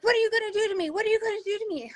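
put-on high, panicky, rushed kid voice; scared kid quote. A parent imitating her scared kid, high and panicky. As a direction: imitate a frightened child, high and fast. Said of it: "They're like".

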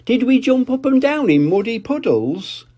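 put-on very high eager child voice; Peppa hopeful. Peppa asking Daddy about muddy puddles, very high. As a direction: ask hopefully like a little pig.

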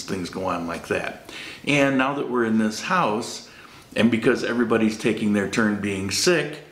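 ordinary delivery

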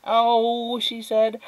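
high, put-on pleasantness; fake adult smile. A narrator's reading. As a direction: answer with a forced smile in the voice. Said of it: "fake adult smile back".

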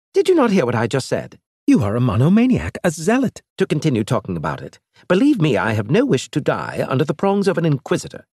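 agitated alien envoy, quick; exasperated alien. Acted. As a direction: rattle it off in disbelief.

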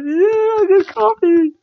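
high, slow squeaky over-caffeinated voice; hyper Nikki impression. Vlogger mimicking Nikki Cross after too much coffee, squeaky high voice. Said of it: "Nikki's like".

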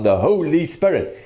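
"Holy Spirit" stretched out for emphasis; stress on Holy Spirit. Retold or imitated. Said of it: "the emphasis is on".

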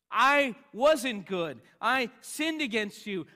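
loud, high cry of a sinner confessing; impassioned confession. Acted. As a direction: cry out your guilt at full force. Acted confession of sin before God.

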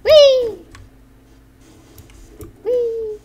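very high drawn whee; gleeful squeal. Acted. Child entering the service room, high gleeful squeals. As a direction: let out high playful squeals.

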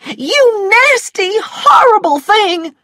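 high, loud, slow shriek of rage; screamed insult. A narrator's reading. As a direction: scream it, stretching every word. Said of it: "she screamed".

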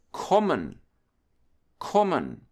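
quick, short vowel in kommen. Shown as a demonstration. German teacher showing double consonants to learners, short O in kommen. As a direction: clip the O before double M in kommen. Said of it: "make the sound shorter, faster".

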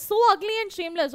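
ordinary delivery

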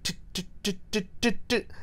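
unvoiced, breathy tick tick tick like a clock; whispered ticking noise. Podcaster mimicking the movie's ticking noise as a soft whisper. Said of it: "It's like".

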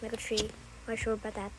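low, soft monotone; name yourself low and evenly; put-on character voice. Child back in Negative Three role, low. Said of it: "this is just a good voice impression".

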